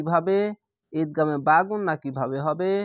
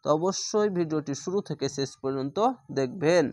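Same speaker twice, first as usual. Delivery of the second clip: ordinary delivery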